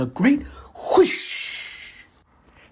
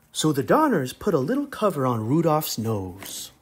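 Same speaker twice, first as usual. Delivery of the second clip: plain reading voice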